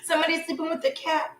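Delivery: ordinary talk, nothing marked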